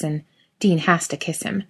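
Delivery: ordinary delivery, nothing marked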